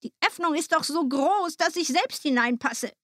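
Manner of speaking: high-pitched, scolding witch voice; exasperated old witch. A narrator's reading. Witch showing Gretel the oven opening, exasperated. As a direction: explain impatiently as the witch. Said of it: "sagte die Alte".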